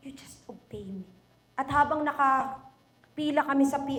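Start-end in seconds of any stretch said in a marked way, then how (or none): 0.1-1.1 much softer, low, gentle command; quiet voice of God. Acted. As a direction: say it softly as God, firm and calm. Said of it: "sabi ng Panginoon". (mahina at mababang tinig ng Diyos)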